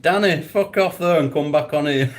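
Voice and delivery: real teasing through laughter; laughing mock dismissal at a friend. Real, not acted. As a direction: tell him off while cracking up. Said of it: "You're making me laugh".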